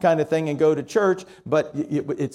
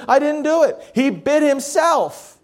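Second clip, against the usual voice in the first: put-on stubborn girl; 8 semitones higher, 3 dB louder than usual. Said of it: "She says".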